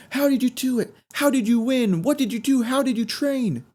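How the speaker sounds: high, insistent reporter questions; pushy reporter. Retold or imitated. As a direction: keep pressing, getting pushier. Reporters keep asking, pushing.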